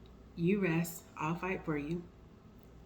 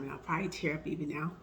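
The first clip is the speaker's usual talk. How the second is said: usual speaking voice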